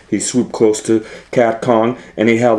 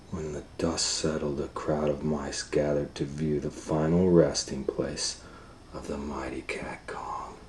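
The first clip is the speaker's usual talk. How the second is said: much softer, lower, nearly whispered; hushed narration; 7 semitones lower, 18 dB quieter than usual. A narrator's reading. Narrator softly telling of the fallen cat. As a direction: hush it like a solemn ending.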